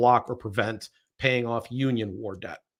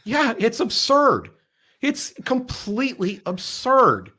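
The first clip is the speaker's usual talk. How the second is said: exasperated; 10 semitones higher, much slower than usual. Real, not acted. Mocking exasperation at absurdity.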